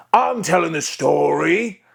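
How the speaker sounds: high, belted pirate yell; character yell. Shown as a demonstration. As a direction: jump into yelling from the throat. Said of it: "into yelling".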